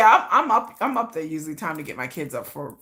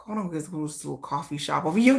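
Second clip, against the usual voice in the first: murmured inner monologue; 3 semitones lower, 6 dB quieter, faster than usual. Retold or imitated. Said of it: "that's how my mind works".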